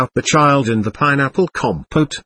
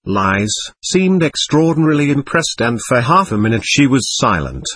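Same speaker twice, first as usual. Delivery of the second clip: ordinary delivery, nothing marked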